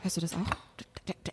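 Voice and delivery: scared hush. Acted. Scared whisper hearing someone.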